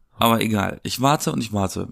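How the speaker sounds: ordinary talk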